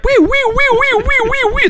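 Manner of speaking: very high whooping siren wee; imitated alarm siren. Telling the co-hosts about the alarm, mimicking a high siren. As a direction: squeal it like a house alarm.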